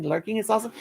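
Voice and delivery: ordinary talk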